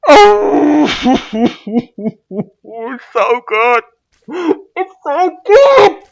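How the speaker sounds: hammed-up loud, high wailing; overcome with emotion. Proud creator breaking down over his maze, mock bawling. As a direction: bawl it, drawn out, mock sobbing. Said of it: "I'm feeling emotional right now".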